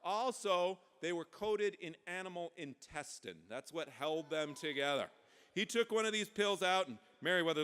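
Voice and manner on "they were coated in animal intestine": plain reading voice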